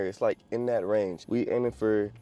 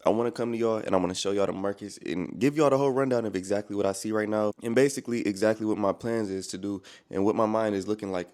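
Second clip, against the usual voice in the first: ordinary talk, nothing marked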